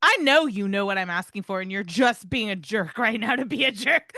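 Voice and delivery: hammed-up dog scolding; dog's exasperation. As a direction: voice the dog's glare. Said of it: "giving me that look like".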